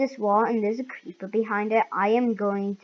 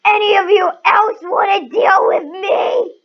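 high, loud taunt; taunting shout; 8 semitones higher, 9 dB louder than usual. Real, not acted. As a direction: challenge them, yelling. Taunting enemies in game.

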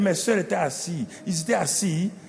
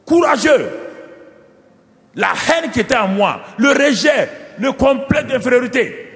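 higher and louder, rising to a fiery climax; preaching at full force; 6 semitones higher, 3 dB louder than usual. Acted. Pastor proclaiming to the congregation how the oil changed David, in a fiery sermon. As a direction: preach it, building to a roar.

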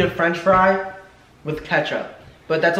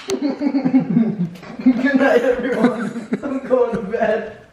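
mock dramatic exit; 7 semitones higher, much slower than usual. Mock exit after punishment.

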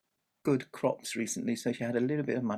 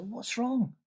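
put-on worried child; 10 semitones higher than usual. A narrator's reading. Child worried about baby.

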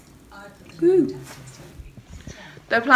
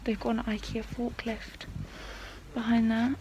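whispering; 10 dB quieter than usual. Real, not acted. Whispering inside a quiet shop.

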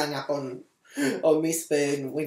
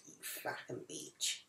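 unvoiced hissed thought; whispered inner curse; 19 dB quieter, slower than usual. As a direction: breathe the insult under your breath. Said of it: "And I thought".

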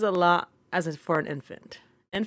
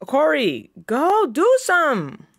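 high, louder, very slow call; drawn-out yell; 7 semitones higher, 5 dB louder, much slower than usual. Real, not acted. Player yelling at Corey to go do something, drawn-out yell. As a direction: stretch it out, fed up.

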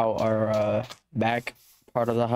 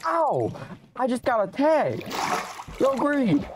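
high panicked yelps; startled yells; 12 semitones higher than usual. Real, not acted. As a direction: yelp in sudden alarm. Attacked by zombies in game.

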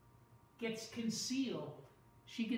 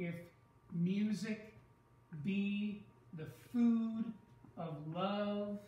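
slow, stressing "be" and "food"; marking the meter; slower than usual. Shown as a demonstration. Teacher marking iambic stresses for class, slow and deliberate. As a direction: tap out the beats, stretching "be the food". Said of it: "Now I'm gonna mark it".

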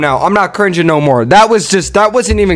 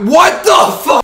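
shocked; 12 semitones higher, slower than usual. Real, not acted. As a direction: blurt it out in shock. Shocked at the video.

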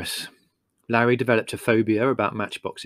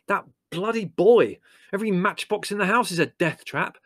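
outraged blaming; 9 semitones higher than usual. Acted. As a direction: rant at the culprit, stretching the first words. Larry blaming the boy.

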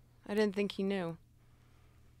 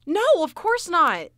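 loud, higher-pitched refusal; indignant denial; 4 semitones higher, 10 dB louder than usual. Acted. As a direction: deny it as if appalled. Asked about using a gun.

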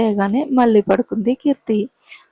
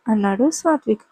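usual speaking voice

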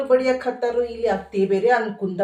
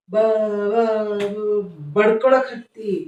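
stammering on the first sound, then slowing; a comic stutterer stuck on each opening syllable; stuttering son; 2 semitones lower, 4 dB quieter, much slower than usual. Acted. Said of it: "ನೀನ್ ನೋಡಿದ್ರೆ ತೊದ್ಲ".

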